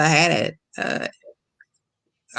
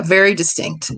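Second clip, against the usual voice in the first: ordinary delivery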